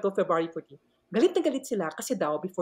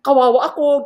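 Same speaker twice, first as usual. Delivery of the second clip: mock pitiful plea; 4 semitones higher, 11 dB louder than usual. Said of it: "pinaiyak ko sila na parang".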